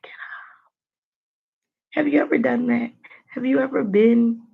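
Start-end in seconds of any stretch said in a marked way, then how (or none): 0.0-0.7 urgent whisper at the screen. Retold or imitated. Viewer whispering at the screen, urgent whisper. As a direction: hiss it urgently to the character. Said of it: "You're like".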